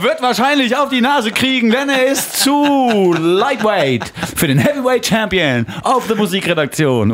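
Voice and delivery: booming high ring announcer voice; boxing announcer. Pretend. Mock boxing ring introduction.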